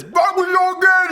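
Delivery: high, loud slowed shout; mock garbled shout. Imitating what the bear hears, high slowed shout. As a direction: yell it as nonsense the bear hears.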